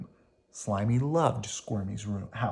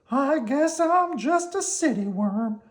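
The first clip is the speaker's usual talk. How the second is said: high, slow worm voice; Slimy decided; 14 semitones higher, slower than usual. Acted. Slimy tells Squirmy he's going home, in a high resigned voice. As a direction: admit it with a shrug.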